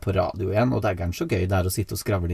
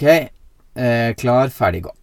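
ordinary talk

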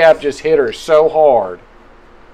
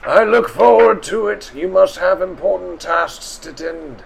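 put-on old man voice; 6 semitones higher than usual.

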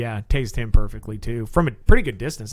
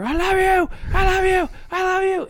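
much higher, stretched I love you; high-pitched imitated love call. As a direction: mimic the naked guy calling out from afar. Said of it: "all of a sudden I hear".

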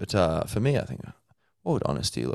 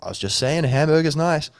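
higher, defensive whiny protest; spiky-haired spontaneity; 8 semitones higher, 4 dB louder than usual. Retold or imitated. Spontaneity defending the hamburger, hammed-up whine. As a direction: protest your innocence with a grin. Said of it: "guy called Spontaneity with bleached, spiky hair".

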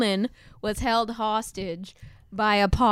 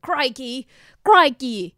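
mock Australian crikey; 4 semitones higher, 6 dB louder, slower than usual. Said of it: "they say crikey".

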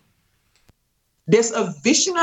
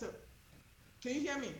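far away sound; 4 semitones higher, 25 dB quieter, faster than usual. Real, not acted. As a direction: voice faint and distant. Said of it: "you're down a long tunnel".

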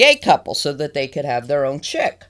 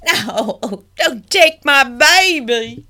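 high, slow pleading penguin; mock pleading; 6 semitones higher, much slower than usual. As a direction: beg in a hammed-up high voice. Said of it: "That poor couple's like".

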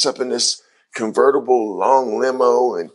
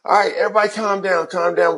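quick, raised-pitch call for calm; hurried, higher crowd-quieting; retold calming down; 6 semitones higher than usual. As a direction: settle an excited room, retold. Retold, quieting excited family.

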